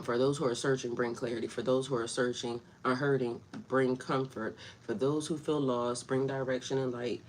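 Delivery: soft, low reverent prayer voice. Acted. Vlogger leading her viewers in prayer, soft and reverent. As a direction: pray it gently and humbly.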